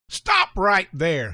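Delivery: put-on slow, firm old man's command. A narrator's reading. Old man stopping the milk-throwing boys, slow firm command. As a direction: halt the boys with slow authority. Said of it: "his voice firm and authoritative".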